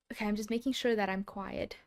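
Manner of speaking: ordinary delivery, nothing marked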